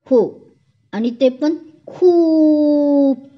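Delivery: pouting. A narrator's reading. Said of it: "आपलं तोंड लांब करत बोलते".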